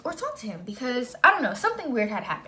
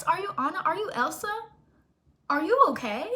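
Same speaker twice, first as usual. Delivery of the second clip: high mocking questions; sarcastic outrage; 5 semitones higher, 4 dB louder than usual. Real, not acted. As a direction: ridicule him with fake concern. Mocking boyfriend about texting.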